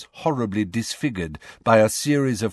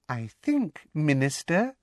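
high, soft, silky voice; sweetly deferential interjection, pitched high; silky and delicate; Umbridge, silky; 6 semitones higher, 3 dB quieter than usual. A narrator's reading. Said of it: "said Umbridge silkily from beside him".

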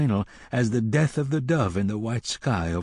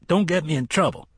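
quick, higher-pitched warning; brusque warning; 7 semitones higher, faster than usual. Acted. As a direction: rattle it off as a brisk order. Cook shooing the boys off.

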